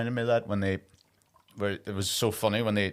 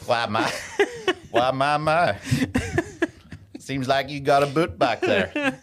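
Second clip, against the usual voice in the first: mock southern belle; 2 semitones higher, slower than usual. Mocking southern belle lines.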